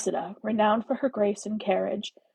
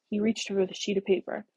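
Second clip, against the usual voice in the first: ordinary delivery, nothing marked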